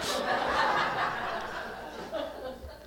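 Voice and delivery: high airy laugh; real laugh. Real, not acted. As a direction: burst out laughing at the punchline. Teacher laughs at own story.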